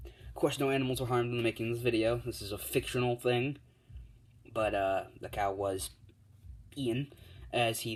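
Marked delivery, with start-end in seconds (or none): 0.1-3.6 quick, slightly raised disclaimer patter; mock disclaimer. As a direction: rattle off the legal notice. Mock disclaimer after killing the cow.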